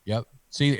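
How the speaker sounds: ordinary delivery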